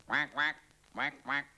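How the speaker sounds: high, loud quacking; duck quack. Acted. Duck in the pond, loud high quacks. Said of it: "thought the duck".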